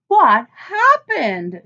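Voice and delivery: high, loud, drawn-out alarm; alarmed teacher. A narrator's reading. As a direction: rush over calling out in worry. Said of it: "she said".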